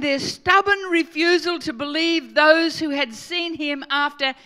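loud, emphatic scripture reading; preacher hammering out the verses; forceful preaching. Real, not acted. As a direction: drive each phrase home loudly to the congregation.

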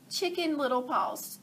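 usual speaking voice

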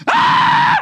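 very high, loud shriek; hammed-up terrified scream; cry of fear. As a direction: scream out in sudden fright. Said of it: "cried out in fear".

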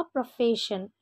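प्रोफेशन with sh, "profeshan"; correct pronunciation. Shown as a demonstration. Teacher modelling the right sound for students, careful sh. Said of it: "correct pronunciation होगा".

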